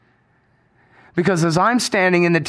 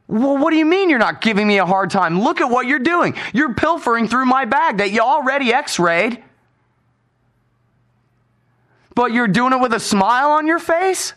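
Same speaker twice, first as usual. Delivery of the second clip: raised, indignant retort in a mock quarrel; retold angry retort; 7 semitones higher than usual. Arguing with the bag screener, indignant raised retort. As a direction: tell the screener off, indignantly, pitched up.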